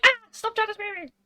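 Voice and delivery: high, quick mock outcry; hammed-up, rushed squeal of protest. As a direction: imitate a girl crying out in fake alarm. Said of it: "caught, she's like".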